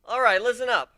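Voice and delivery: loud, commanding call to the room. Acted. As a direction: grab the recruits' attention. Addressing recruits.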